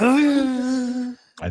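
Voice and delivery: high, very slow crying wail; mock dramatic wail. Host predicting AI in the movie, a mock tearful wail. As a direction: wail it out like an overwrought prophet.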